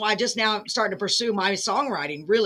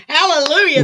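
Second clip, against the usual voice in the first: excited; 7 semitones higher, 8 dB louder than usual. Real, not acted. Praising the song just sung.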